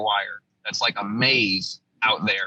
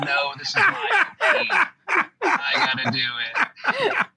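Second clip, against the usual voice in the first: much higher, slow, hammed-up hero voice on "No, this is my O P. I gotta do it."; mock heroic; slower than usual. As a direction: ham up the brave soldier line, way up high and drawn out. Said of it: "They make fun of me to this day".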